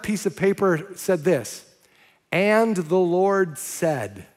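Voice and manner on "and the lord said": drawn-out, each word weighed; slow dramatic reading. Acted. Preacher reads the torn scrap, slow and weighty. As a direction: read it slowly like a mystery.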